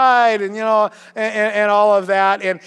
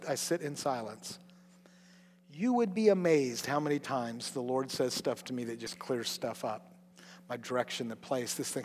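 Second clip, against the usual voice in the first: much softer, lower, intimate; quiet intimate confiding; hushed; 8 semitones lower, 15 dB quieter than usual. Real, not acted. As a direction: speak as if in silent prayer. Speaking of silent prayer.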